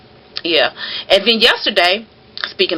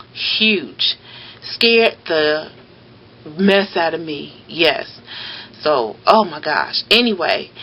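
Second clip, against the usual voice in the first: ordinary delivery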